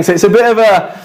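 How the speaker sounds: ordinary talk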